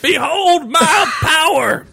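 slow, high pompous boast; grand hero proclamation. Pretend. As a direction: proclaim it like a superhero. Mock heroic proclamation.

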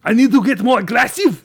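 put-on aggressive German accent. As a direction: throw it back in indignant fake German. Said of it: "your German is not very aggressive".

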